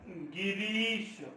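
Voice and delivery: गिरीश with a very drawn-out long री; long vowel shown. Shown as a demonstration. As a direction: stretch the ई in गिरीश slowly to show it is long. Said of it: "दीर्घ स्वर येईल". (गिरीश मधली दीर्घ ई लांबवून)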